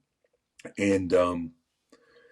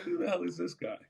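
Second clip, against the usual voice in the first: high, softer mock bafflement; bewildered aside; 9 semitones higher, 5 dB quieter than usual. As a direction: wonder it to yourself, pitched up. Baffled by the stranger.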